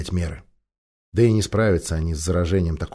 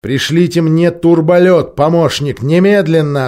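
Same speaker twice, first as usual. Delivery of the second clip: loud, slow, cutting command; curt order; 7 semitones higher, 7 dB louder, slower than usual. A narrator's reading. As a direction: cut her off sharply. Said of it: "отрезал Арториус".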